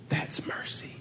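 whispered punchline. Real, not acted. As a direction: whisper it, letting it land. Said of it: "whispered point".

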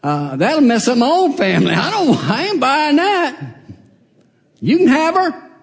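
loud, high, outraged no; incredulous refusal. Acted. The kinsman refuses to take Ruth, loud and incredulous. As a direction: throw the deal back at him in disbelief. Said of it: "Guy says".

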